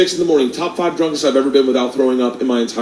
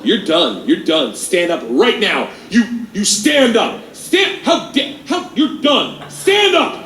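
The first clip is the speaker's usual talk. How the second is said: slow commands climbing to high, flustered orders; confident chaperone; 5 semitones higher, slower than usual. Retold or imitated. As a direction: imitate a teacher losing her grip on authority. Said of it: "liberal arts confidence".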